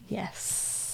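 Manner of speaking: soft breathy whisper. Real, not acted. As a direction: whisper it under your breath. Unvoiced quiet reply.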